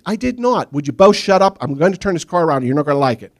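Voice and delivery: loud exasperated scolding; kids and dad squabbling. Retold or imitated. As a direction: threaten to turn the car around. Brother and dad argue.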